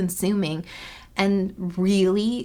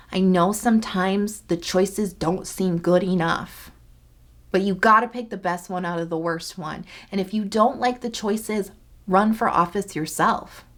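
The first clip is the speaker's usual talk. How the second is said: ordinary delivery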